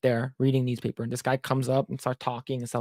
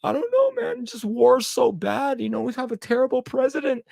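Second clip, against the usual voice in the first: mock crying; 9 semitones higher than usual. Storyteller mocking the crying stranger at Starbucks in a high whine. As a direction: imitate a grown man blubbering. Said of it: "motherfucker starts crying".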